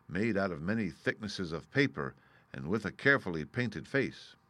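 ordinary delivery, nothing marked

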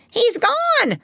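very high, startled cry; piercingly high excited parrot voice. A narrator's reading. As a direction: exclaim in alarm, pitch leaping way up. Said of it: "cried Polynesia".

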